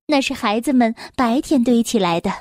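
ordinary delivery

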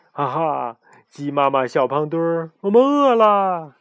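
high, whiny hungry chicks; whiny chick voices. Acted.

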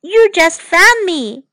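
high-pitched protest; crying out. A narrator's reading. Oliver to Mother who fell on him, high cry. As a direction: cry out as if just landed on. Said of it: "奥利弗喊了起来".

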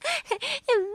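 high bursting laugh. Acted. Frog cracking up over the tongue story, high laugh. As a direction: crack up at the joke.

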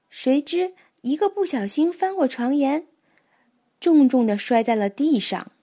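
plain reading voice

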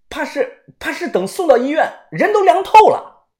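high, hurried and worried; urgent warning of no time left; anxious. A narrator's reading. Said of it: "小道士着急地说道".

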